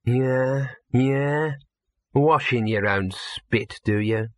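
level, drawn-out sneer; low, level voice. A narrator's reading. Dog remarks on the cat person, level voice. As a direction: keep it level and unhurried. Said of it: "A low, level voice said".

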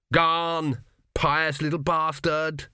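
exasperated schoolboy insult; irritated jeer. A narrator's reading. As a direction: jeer across the dormitory, drawn out. Thrown pillow, insult at Arthur.

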